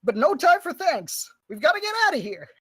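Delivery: brisk, high-pitched ship captain; Grafford. Acted. Captain to rescued climbers, urgent high character voice. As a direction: introduce yourself as an urgent captain.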